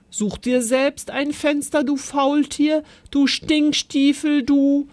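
put-on child berating his friend over a wrecked rocket; angry scolding. A narrator's reading. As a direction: tell the friend off, name-calling. Child scolding playmate in anger.

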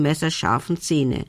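usual speaking voice

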